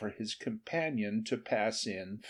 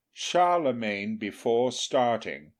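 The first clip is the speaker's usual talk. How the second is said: ordinary delivery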